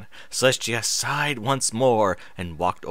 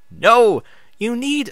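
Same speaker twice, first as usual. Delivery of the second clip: sharp high cry; 12 semitones higher, 7 dB louder than usual. Acted. Moon Shadow cries out.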